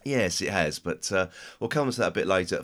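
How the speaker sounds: ordinary talk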